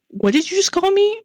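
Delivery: high-pitched, retold disbelief; mock incredulous. Imitating Cody's reaction to a nickname, mock incredulous. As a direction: jump up high as if affronted.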